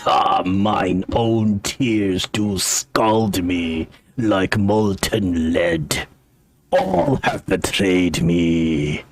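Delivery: low, very slow tragic lament; drawn-out theatrical dwarf voice, deep in self-pity; mad old dwarf. Acted. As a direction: declaim your woes like a crazed, betrayed old king. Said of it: "this dwarf is quite mad".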